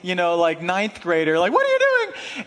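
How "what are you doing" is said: sudden, very high, loud yelp; startled yell. Retold or imitated. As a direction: shriek it in shock. Pastor bursts out, startled.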